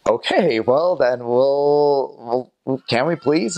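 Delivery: stall, then gently plead after being refused; hesitant pleading. Retold or imitated. Retold plea after refused prayer.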